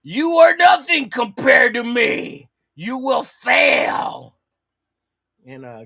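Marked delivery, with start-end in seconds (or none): 0.0-4.4 booming slow supervillain shout, much higher and louder; drawn-out comic-book villain proclamation; villain taunt. Acted. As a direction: loom over the hero and sneer each word. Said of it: "who's gonna be saying here".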